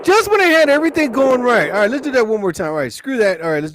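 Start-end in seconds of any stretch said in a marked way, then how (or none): 0.0-1.7 higher and louder frustrated yell; real annoyed outburst; exasperated outburst. Real, not acted. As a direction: blurt it out, fed up with the glitch. Frustrated outburst after flub.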